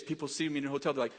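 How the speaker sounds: normal talking voice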